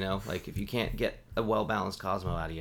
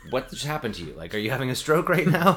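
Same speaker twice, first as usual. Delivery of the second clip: incredulous, building higher and louder; mock alarm; 4 semitones higher, 6 dB louder than usual. Bartender reacting to coworker's odd word, mock alarm. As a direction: react as if something is badly wrong.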